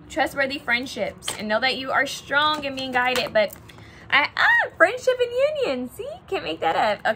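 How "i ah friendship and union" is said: excited squeal. Real, not acted. Card shows friendship again, surprised.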